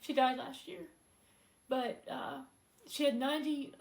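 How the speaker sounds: holding back tears as she speaks of her mother; emotional. Real, not acted. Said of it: "I'm a little bit emotional about it".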